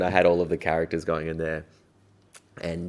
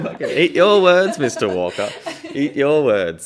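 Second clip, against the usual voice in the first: loud, high laughing gloat; gleeful taunt; 8 semitones higher, 7 dB louder than usual. Real, not acted. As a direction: rub it in, grinning. Triumphant retort at old teacher.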